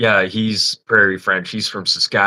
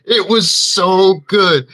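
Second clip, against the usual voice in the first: high, slow, laughing praise; giddy laughing; laughing delight; 9 semitones higher, 6 dB louder, slower than usual. Real, not acted. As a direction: gush through laughter. Laughing after story.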